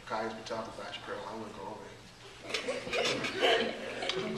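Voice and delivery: very soft, low tattling aside; gossipy confiding. Acted. Speaker passing gossip back to the other person, hushed and confiding. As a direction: tip them off under your voice.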